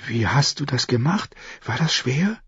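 put-on soft, high girl's voice; amazed young girl. A narrator's reading. Girl asking how he changed his face, hushed wonder. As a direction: ask in hushed wonder, pitch climbing. Astonished girl questions Jaqen.

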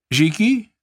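high called name; calling out. A narrator's reading. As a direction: call the servant across the box. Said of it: "rief sie".

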